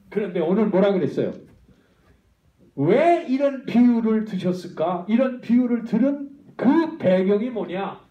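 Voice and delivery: loud rhetorical challenge, the middle question slowed; loud preaching question. Acted. Pastor challenging the congregation, loud. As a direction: demand the answer from the hall.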